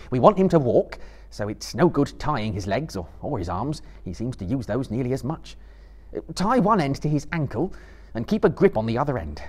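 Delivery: firm, practical orders; Frodo. A narrator's reading. Frodo tells Sam to tie the ankle, firmly.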